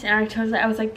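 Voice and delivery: usual speaking voice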